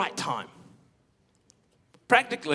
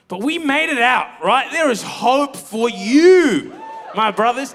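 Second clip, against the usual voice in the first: slow, loud and higher, rallying the brothers; preacher's shout; 5 semitones higher, 9 dB louder, slower than usual. Real, not acted. Preacher calling out to his brothers: slow, loud shout. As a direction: shout it out as a hopeful rallying cry.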